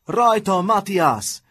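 high, loud rallying call; cheering shout. A narrator's reading. Sebastian cheering Matthias on in the fist fight, shouting. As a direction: shout him on from the sidelines. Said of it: "kiáltott oda Sebastian".

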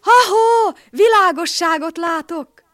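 very high, loud excited yell; whooping shout. A narrator's reading. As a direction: holler from the treetop. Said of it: "nagyot kurjantott".

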